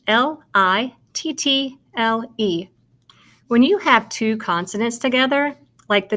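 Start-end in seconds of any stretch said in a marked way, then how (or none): 0.1-2.7 slow "L I T T L E", letter by letter; spelling aloud. Shown as a demonstration. Teacher spelling little for pupils, spelling aloud. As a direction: give each letter of "L I T T L E" its own beat. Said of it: "Let's spell it".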